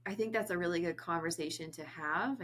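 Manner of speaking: ordinary talk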